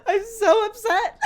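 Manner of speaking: half-laughing mock sob; mock bawling. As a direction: ham up the tearful wail, then break into a laugh. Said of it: "I was like".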